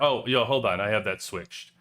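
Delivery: ordinary talk, nothing marked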